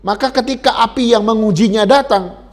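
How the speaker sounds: loud emphatic sermon warning; forceful preaching. Acted. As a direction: preach it out to the congregation.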